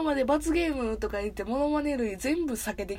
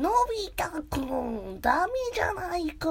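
Doraemon; 6 semitones higher than usual. Acted. Robot cat scolding Nobita, high mock cartoon voice. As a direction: stretch each word, breaking toward laughter. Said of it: "わさびさんバージョンのドラえもん行こう".

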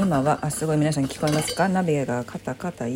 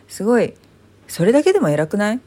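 excited; 4 semitones higher, 7 dB louder, faster than usual. Real, not acted. Proudly praising her own chores.

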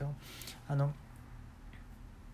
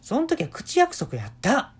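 insistent daughter; 9 semitones higher, 4 dB louder than usual. Retold or imitated.

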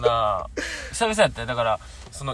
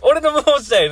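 put-on loud, high and laughing, like a cocky young comic; hyped young comedian; 11 semitones higher, 8 dB louder than usual. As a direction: pump it up like a rookie comedian on stage. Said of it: "イケイケの若手芸人みたいな声でやってる". (イケイケの若手芸人風に、笑いながら高く大きく)

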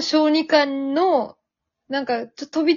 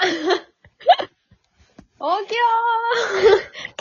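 calling out; 10 semitones higher, 4 dB louder, much slower than usual. Pretend. Mock mother waking someone.

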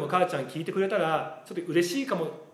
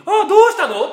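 much higher, louder, laughing mom's greeting; mom's raised phone voice; 13 semitones higher, 11 dB louder than usual. Retold or imitated. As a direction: play the happy mother picking up the phone. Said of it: "ってもうワントーン上がってるもん".

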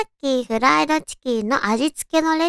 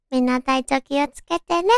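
ordinary talk

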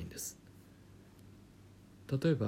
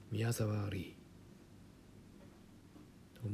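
a hushed, drawn-out 宮沢リー, like a film name; 宮沢リー stretched like a kung fu star; Bruce Lee joke; 10 dB quieter, much slower than usual. Retold or imitated. Teacher jokes that 宮沢リー sounds like Enter the Dragon. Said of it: "燃えよドラゴンみたいな感じ".

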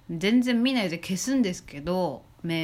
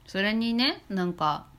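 ordinary talk, nothing marked

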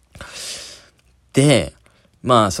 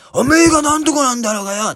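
higher rough mock shout, slurred; a drunk spitting blame; imitated brawl yelling; 11 semitones higher than usual. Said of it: "とか言って". (荒っぽい怒鳴り声の真似)

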